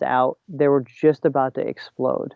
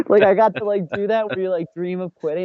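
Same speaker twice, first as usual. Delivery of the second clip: laughing; 5 semitones higher than usual. Real, not acted. Laughing over the dream quit.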